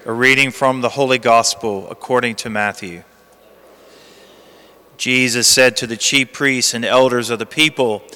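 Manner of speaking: low, hushed Gospel reading; cathedral echo. Acted. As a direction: read the Gospel solemnly, like in church. Liturgical Gospel reading at the lectern.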